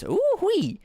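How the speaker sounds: high Southern whoop; soaring Southern holler; Southern accent. Acted. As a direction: whoop it like a cowboy. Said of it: "stop me from doing this damn accent".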